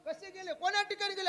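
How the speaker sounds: high, loud village dialogue; high loud rustic exchange; village wife asking. Acted. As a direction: voice the worried back-and-forth.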